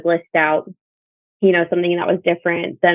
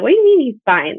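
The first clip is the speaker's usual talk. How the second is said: pitched up, louder disbelieving demand; incredulous; 6 semitones higher, 3 dB louder than usual. Retold or imitated. Mother retelling how she challenged the doctor, incredulous. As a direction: push back hard at the doctor in disbelief.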